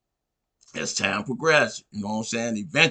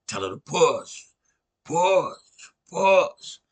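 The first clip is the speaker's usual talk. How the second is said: each push higher and louder, building; urging push; 4 semitones higher, 4 dB louder, much slower than usual. Retold or imitated. Doctor urging mother in labor, push building louder. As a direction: coach her on like a delivery doctor.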